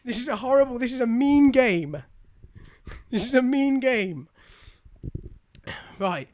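usual speaking voice

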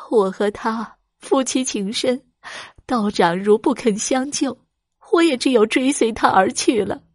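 a touch of tearful begging; pleading wife. Acted. Wife begs priest to save her husband, mild tearful plea. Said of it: "放声大哭起来".

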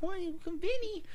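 very high mock New York woman; high-pitched New York movie-girl accent; New York accent impression. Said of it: "with that accent".

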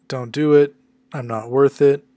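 put-on loud, pleading voice for Old Man Logan. Old Logan begs Mariko not to risk it, pleading loudly. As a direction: beg her not to do it, loudly.

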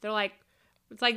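ordinary talk

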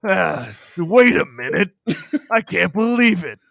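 half-hearted dramatic astonishment; mock disbelief. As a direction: let it dawn on you, imitating a stunned man. Acting out the character's slow realization.

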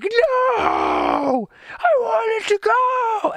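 high, drawn-out wailing protest, slowed right down; shouted high-pitched whine of a disappointed kid; upset child's wail. Retold or imitated. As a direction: cry out like a child whose treat was snatched away. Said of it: "Titus was a wreck".

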